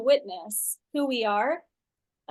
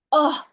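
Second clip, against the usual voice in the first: loud, higher acted groan; exasperated groan; 4 semitones higher, 7 dB louder than usual. Shown as a demonstration. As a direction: let out a loud ugh. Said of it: "I go through this".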